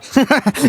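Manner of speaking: short high chuckle; amused laugh. Acted. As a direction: laugh at her cheekily. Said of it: "He laughed at her".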